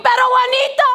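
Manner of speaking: loud, high, hammed-up Spanish wailing; screaming telenovela heroine. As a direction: scream it like a melodramatic novela star. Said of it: "She comes in like a bat out of hell screaming".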